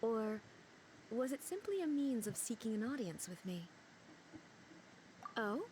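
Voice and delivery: ordinary delivery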